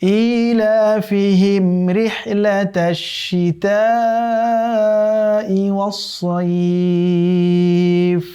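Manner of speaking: slow, drawn-out melodic tilawat; Quran recitation. Shown as a demonstration. Teacher recites the verse for students in slow melodic chant. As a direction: chant the verse slowly with long held vowels. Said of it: "তো আবারও পড়ছি আমরা".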